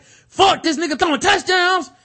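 high, loud frustrated curse; a sudden high yell of envy; frustrated outburst. Retold or imitated. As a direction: blurt it out, jealous and furious. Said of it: "Then he was like".

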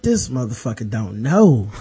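low, quiet thought to himself; retold aside. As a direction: drop into an understated knowing aside. Retold inner reaction to opponent's taunt.

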